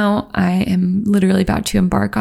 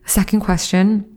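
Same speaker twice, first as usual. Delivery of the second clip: ordinary delivery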